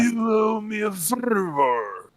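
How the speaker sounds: slow, high, rolled-R mobster impression; Marlon Brando. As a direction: drag it out like a fat Godfather. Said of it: "Marlon Brando, fat version with rolling R's".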